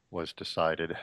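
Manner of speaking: ordinary talk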